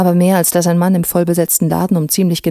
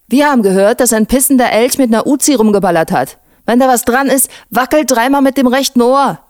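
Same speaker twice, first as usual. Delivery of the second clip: higher-pitched, animated character voice; raised, lively teasing; cheeky young cop; 5 semitones higher, 4 dB louder than usual. A narrator's reading. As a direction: play the cocky young policeman telling a tall tale. Voices Erkan winding up colleague.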